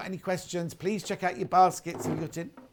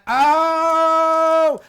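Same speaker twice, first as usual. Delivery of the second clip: excited; 10 semitones higher, 11 dB louder than usual. Real, not acted. Delight, jumpers are back.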